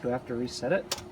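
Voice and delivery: ordinary talk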